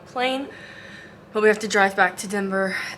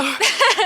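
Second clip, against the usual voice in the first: high burst of laughter; 11 semitones higher, 4 dB louder than usual. Real, not acted. Laughs at no award.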